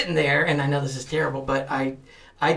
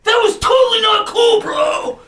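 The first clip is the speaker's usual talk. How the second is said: imitating a furious shout, much higher and louder; angry actor; 6 dB louder, slower than usual. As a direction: chew him out like an outraged fellow actor. Angry actor scolding the prankster.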